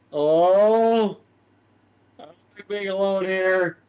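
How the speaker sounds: scared whine to Sarah about being left alone; whining Todrick. Acted. Whiny, scared in the cave. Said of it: "He's just, like, whining".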